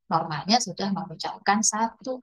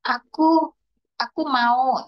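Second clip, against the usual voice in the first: put-on toddler wanting; 4 semitones higher, slower than usual. Shown as a demonstration. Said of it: "gitu ya".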